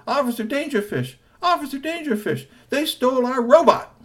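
put-on high, pleading kid voice; urgent tattling kid. Three T telling the officer the men stole the robot, high pleading. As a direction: tell on them to the officer.

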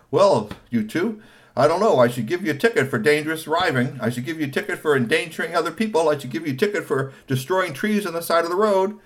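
loud, lecturing police officer; stern officer. Acted. Officer scolding the two drivers, loud lecturing voice. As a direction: scold them like a traffic cop.

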